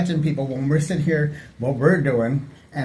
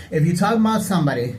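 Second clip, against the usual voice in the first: ordinary delivery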